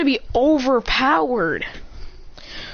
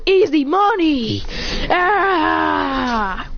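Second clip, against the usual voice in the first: high, drawn-out victory cheer; gloating cheer; 5 semitones higher, much slower than usual. Acted. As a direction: crow it, then trail into a long cry. Acted gloating after the win.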